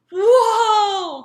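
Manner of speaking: loud, high shocked whoa; startled cry. A narrator's reading. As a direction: cry out as the sleigh lurches. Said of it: "cried Santa".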